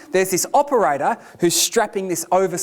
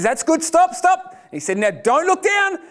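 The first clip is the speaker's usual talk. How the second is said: operator calling out; 9 semitones higher than usual. Retold or imitated. Operator calls out instructions.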